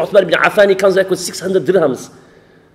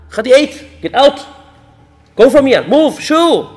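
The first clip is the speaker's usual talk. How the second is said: acted scornful father, pitched up; scornful indignation; 3 semitones higher than usual. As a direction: dismiss the lowly suitor in mock outrage. Rich father sends suitor away.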